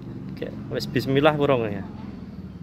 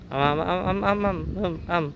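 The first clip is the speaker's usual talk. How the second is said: nom nom; 7 semitones higher than usual. Pretend. Feeding the child, cooing.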